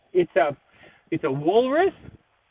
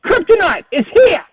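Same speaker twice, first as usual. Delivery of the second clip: excited yell at full volume; loud then suddenly hushed; 11 dB louder than usual. Real, not acted. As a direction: shout it out, thrilled. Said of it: "I yelled that a bit loud".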